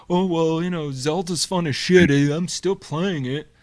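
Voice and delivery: defensive gamer voice. Retold or imitated. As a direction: justify the purchase out loud, a bit loud. Imagined buyer defending purchase.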